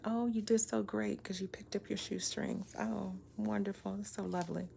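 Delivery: soft, breathy sarcastic praise, almost whispered; mock gushing praise. Mocking men who want praise, in a soft sarcastic coo. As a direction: coo fake praise at a grown man like he's a child. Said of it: "they wanna see the smile".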